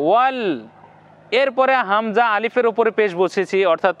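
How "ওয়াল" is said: ওয়াল with a closed final ল; demo pronunciation. Shown as a demonstration. Teacher modelling ওয়াল for students. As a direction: clip the ল short after ওয়া. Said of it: "হসন্ত আকারে উচ্চারণ হবে".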